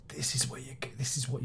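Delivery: a breathy hushed aside; whispered. Acted. Reviewer suddenly whispering to viewers, hushed. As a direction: drop to a whisper. Quiet aside mid-sentence.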